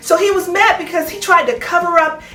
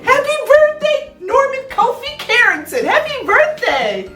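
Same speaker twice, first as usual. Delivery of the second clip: celebratory singsong; 8 semitones higher than usual. Real, not acted. Host wishing the soundman happy birthday, celebratory singsong. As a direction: sing out the greeting high.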